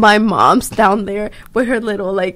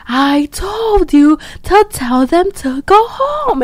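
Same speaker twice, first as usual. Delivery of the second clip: imitating an angry dad, higher and slow; slow, higher scolding as the strict father; mock dad yelling; 8 semitones higher, slower than usual. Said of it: "And he, like, screaming".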